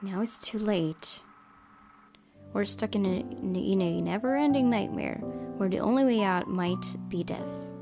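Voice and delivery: ordinary talk, nothing marked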